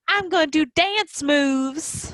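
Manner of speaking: high, slow Southern drawl imitating a child. Mom imitates her son at play in a southern drawl. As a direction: draw it out like a kid playing Southern. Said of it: "doing, like, a southern drawl".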